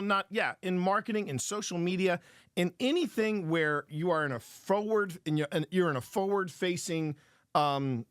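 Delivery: ordinary talk, nothing marked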